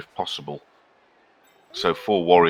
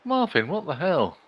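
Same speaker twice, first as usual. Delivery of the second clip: 1 surprised at cat; 9 semitones higher than usual. Real, not acted. Scolding the jumping cat.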